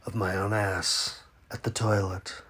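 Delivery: low, soft sheepish mutter; muttered aside. Acted. Comedian mutters a toilet joke while reading the letter. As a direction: slip the punchline out quietly.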